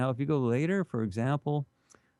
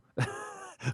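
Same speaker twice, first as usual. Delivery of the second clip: amused laugh; 5 dB quieter than usual. Real, not acted. Laughing while telling the story.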